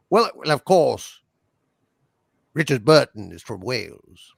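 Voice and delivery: put-on lightly stagey British accent; a touch of theatrical British.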